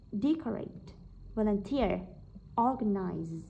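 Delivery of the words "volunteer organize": ordinary delivery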